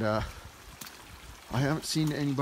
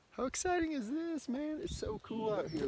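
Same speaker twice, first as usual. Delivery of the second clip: thrilled; 12 semitones higher, 8 dB quieter than usual. Real, not acted. Excited at the barn.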